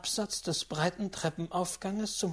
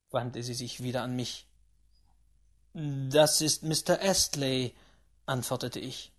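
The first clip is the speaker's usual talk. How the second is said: ordinary delivery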